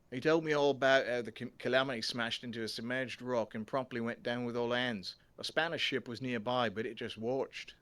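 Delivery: put-on loud, hearty Australian accent for the ghost storyteller. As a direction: play it as a booming Australian bloke. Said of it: "he looks like he's Australian".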